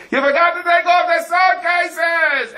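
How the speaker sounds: high, loud, drawn-out yell; frantic call to a driver pulling away; yelling after the driver. Retold or imitated. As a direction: shout it after a departing coach. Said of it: "they yell after the driver".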